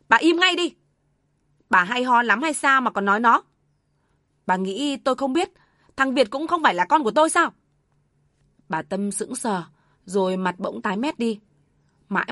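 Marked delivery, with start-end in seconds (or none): 0.1-7.5 high, loud stern rebuke; angry shout. Acted. Silent father suddenly shouting at his wife, furious. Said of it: "bỗng quát lên".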